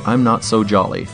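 ordinary delivery

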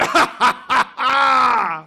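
loud, mocking giant's guffaw; hammed-up godly roar of laughter; booming laugh. Sky god scoffs at Anansi's wish, booming laugh.

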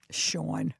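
Sean with a New York vowel. Shown as a demonstration. Host says Sean the way New Yorkers do. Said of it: "one name I'm really always worried about".

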